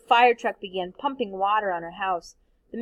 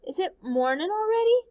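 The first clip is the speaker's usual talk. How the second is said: put-on sleepy child voice; 9 semitones higher than usual. Sleepy child waking at night.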